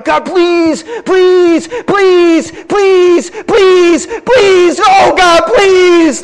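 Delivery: high, loud wailing plea; hammed-up begging, each please hammered out; mock desperate pleading. People begging God for a blessing, mock desperate pleading.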